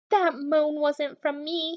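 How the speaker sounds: plain reading voice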